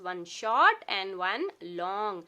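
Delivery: very slow, word by word; slow teacher emphasis. Shown as a demonstration. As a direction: spell it out for kids. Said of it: "याद दिलाते रहना है".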